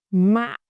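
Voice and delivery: "mạ" short, dropping quickly; heavy low tone. Shown as a demonstration. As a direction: drop "mạ" hard and cut it short. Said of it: "is a heavy low tone".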